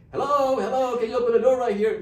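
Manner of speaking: loud, high and fast call through a door. Retold or imitated. Man at the door calling to the old lady, loud high call. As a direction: call up to someone behind glass.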